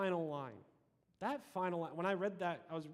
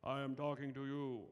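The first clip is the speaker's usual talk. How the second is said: lightly voiced booming God; 2 semitones lower than usual. Shown as a demonstration. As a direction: speak as God, big and commanding. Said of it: "that loud, booming voice like".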